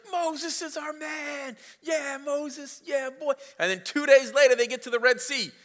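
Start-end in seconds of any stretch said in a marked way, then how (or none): 0.1-3.3 cheering crowd. Retold or imitated. Preacher voicing Israelites cheering for Moses, high hammed-up cheer. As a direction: cheer him on like a whole crowd. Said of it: "all of the Israelites are like".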